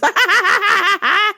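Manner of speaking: high loud burst of laughter; shrill laughing burst. Real, not acted. Laughs at own joke.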